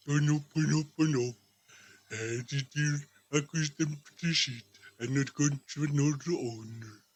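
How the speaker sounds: low mumbling prophetic bird. Acted. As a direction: croak it out like an old parrot oracle. Parrot's low croaking prophecy.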